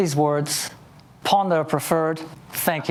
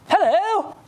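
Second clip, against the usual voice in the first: cheery hello; 13 semitones higher than usual. Retold or imitated. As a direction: call it out eagerly to a stranger. Cheerfully greeting a stranger on pavement.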